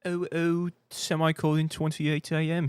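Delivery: high, drawn-out mock British accent; mock fancy British. Streamer mocking a viewer's military time, mock British. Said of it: "say everything in a fancy way".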